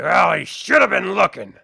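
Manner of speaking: loud, dismissive orc shrug; callous orc captain. Acted. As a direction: brush it off, no remorse. Captain shrugs off killing soldier.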